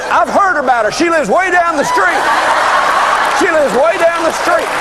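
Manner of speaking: preacher hamming up the joke's last line; punchline delivery. Retold or imitated. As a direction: land the punchline with a grin. Punchline, husband blaming neighbor woman.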